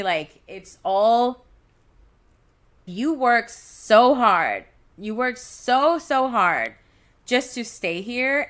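pushing through real tears; emotional. Real, not acted. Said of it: "I'm getting emotional right now".

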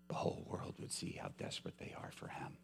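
hushed whispered aside. Real, not acted. Preacher urging his congregation, hushed whisper. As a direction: drop to a whisper to draw them in.

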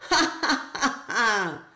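spelled-out scornful ha ha; mocking laugh. A narrator's reading. Puma laughs off the grasshopper's demand, scornful laughing. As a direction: laugh down at the tiny insect. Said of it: "roared the puma".

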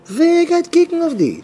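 very high, loud challenge; impassioned outcry. Acted. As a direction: fling the question out, voice soaring. Preacher cries out rhetorical question.

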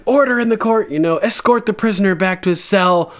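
lightly voiced stern judge; mock judge. Tywin ordering the prisoner taken away, mock commanding.

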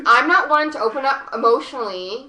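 usual speaking voice

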